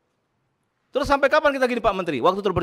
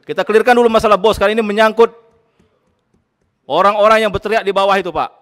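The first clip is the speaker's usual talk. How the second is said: louder, hammering each phrase home; forceful demand; 7 dB louder than usual. Real, not acted. Committee chair to the minister, insisting in a raised voice.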